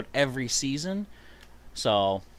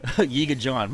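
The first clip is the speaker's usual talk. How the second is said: ordinary delivery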